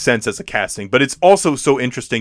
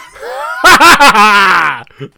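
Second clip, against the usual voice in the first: loud, hammed-up maniacal cackle; mad villain laugh; 9 semitones higher, 9 dB louder than usual. Hunter exulting over Dracula, maniacal laugh. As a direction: let out a big mock-crazed laugh. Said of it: "and then he just laughs".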